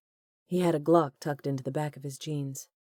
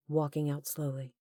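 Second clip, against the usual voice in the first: usual speaking voice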